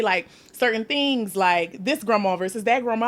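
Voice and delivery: ordinary talk, nothing marked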